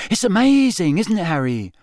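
high, gushing schoolboy; excited. A narrator's reading. Young fan to Harry, excited. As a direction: burst out high and thrilled, then ease down. Excited boy gushes to his hero.

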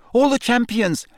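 put-on very high boyish squeak. A narrator's reading. Pupil interrupted by teacher, squeaking. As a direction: squeak it urgently until cut off. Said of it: "squeaked Colin".

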